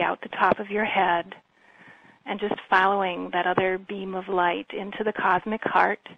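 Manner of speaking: calm, even meditation voice with long pauses; guided meditation. Real, not acted. Healer leading listeners through breathing and visualization, calm guided meditation. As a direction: guide them gently, leaving space between phrases.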